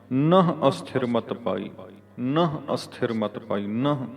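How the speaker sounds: ਨਹ said "nah" with a plain short a; slow model reading with open "nah" for ਨਹ; straight ਨਹ. Shown as a demonstration. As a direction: punch ਨਹ as "na-h", then read slowly. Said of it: "ਸਿੱਧਾ ਨਹ".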